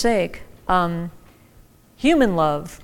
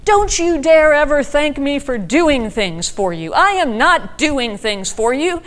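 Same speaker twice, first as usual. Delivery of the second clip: louder, slow, fierce mother's rebuke; retold motherly scolding, slow and louder; mock fierce scold; 6 semitones higher, 5 dB louder, slower than usual. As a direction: rebuke slowly and louder, a hurt mom. Crying mother scolds daughter.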